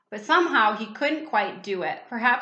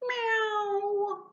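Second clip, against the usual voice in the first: high, soft mewing meow; cat meow; 11 semitones higher, 3 dB quieter than usual. Acted. Cat calling at the door, high soft mew.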